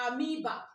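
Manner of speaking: amoeba said a-MEE-ba, no O sound; right pronunciation. Shown as a demonstration. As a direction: say amoeba with "oe" as a long ee. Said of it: "The right pronunciation is".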